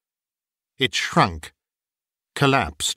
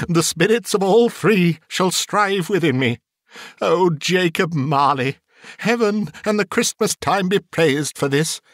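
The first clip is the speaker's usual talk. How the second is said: higher-pitched, fervent thanks; fluttered, broken-voiced Scrooge; 6 semitones higher than usual. A narrator's reading. Scrooge thanks Jacob Marley on his knees, fluttered and fervent. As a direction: give thanks overcome with good intentions. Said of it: "his broken voice would scarcely answer to his call".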